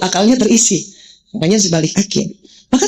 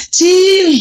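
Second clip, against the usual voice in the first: put-on old slang; 8 semitones higher, 3 dB louder than usual. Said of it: "Zaman dulu kan gitu".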